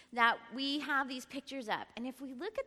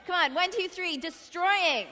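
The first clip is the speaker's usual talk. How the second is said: rally the crowd loudly on destroying; loud call-out; 7 semitones higher, 10 dB louder than usual. Real, not acted. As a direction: shout it out to the room, drawn out. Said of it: "Say it like you mean it".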